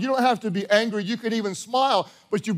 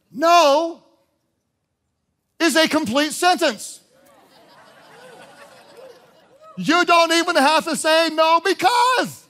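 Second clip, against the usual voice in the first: forceful preaching; 6 semitones higher, 4 dB louder than usual. Real, not acted. Preacher exhorting the congregation not to waste time.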